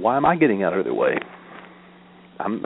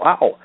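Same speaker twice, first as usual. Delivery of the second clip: higher, louder "ow"; quick playful cry of pain; mock pained yelp; 7 semitones higher, 5 dB louder than usual. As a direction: yelp as if whacked with a stick. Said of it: "You go".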